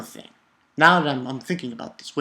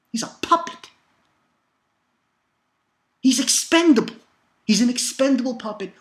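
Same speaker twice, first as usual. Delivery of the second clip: worked-up rant; 9 semitones higher than usual. Real, not acted. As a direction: spit it out, fired up and exasperated. Ranting about the character.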